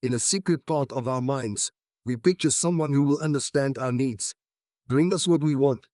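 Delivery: ordinary delivery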